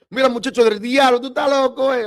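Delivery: high, loud shrill-free scold in Spanish; scolding Dominican mother. Retold or imitated. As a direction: play the mom tearing into her son. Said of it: "I don't wanna say it, but".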